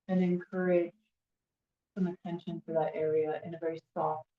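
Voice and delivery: hushed guidance, phrase by phrase; guided meditation. Real, not acted. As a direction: settle them gently into stillness. Leads a standing meditation.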